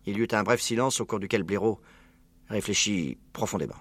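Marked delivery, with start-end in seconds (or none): none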